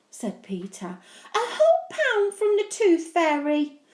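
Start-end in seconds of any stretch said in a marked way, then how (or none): 1.3-3.8 little brother. Acted. Peter thrilled.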